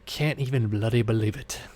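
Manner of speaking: soft mock British accent; playful put-on British accent. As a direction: say it like a Brit, lightly. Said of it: "stop with that accent".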